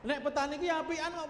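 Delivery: ordinary talk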